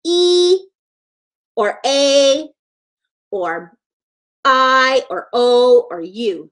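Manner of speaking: E, A, I, O, U said as their letter names; pure, held long vowels in E, A, I, O, U; long vowel sounds. Shown as a demonstration. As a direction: draw out E, A, I, O, U, each on its own. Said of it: "a long vowel always says".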